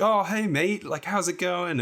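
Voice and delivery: high-pitched mock English greeting; butchered English accent. Famous climber greeting a fan, imitated in a butchered English accent. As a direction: put on a bad, chummy English accent. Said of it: "butchering your, your English accent".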